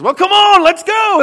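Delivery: very high, loud rallying cry; fired-up holler; excited shout. Retold or imitated. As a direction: whip him up to charge out. Preacher urging him again.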